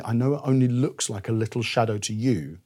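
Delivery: quiet, earnest doctor's warning; a hint of a caring doctor warning gently; loving doctor. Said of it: "a loving doctor at that point would say".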